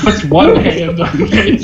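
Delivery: laughing correction, punching "one"; stress on ONE. Real, not acted. Correcting himself after "you only have one hand", laughing stress on "one". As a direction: hit the word "one" hard while laughing.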